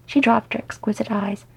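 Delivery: plain reading voice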